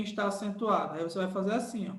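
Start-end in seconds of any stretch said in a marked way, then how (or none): none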